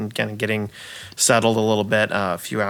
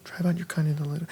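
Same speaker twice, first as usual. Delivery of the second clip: soft, under-the-breath run-through; muttered line recall; 4 semitones higher, 9 dB quieter, much faster than usual. Acted. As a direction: mumble it to yourself, searching for the line. Actor's line muttered while recalling it.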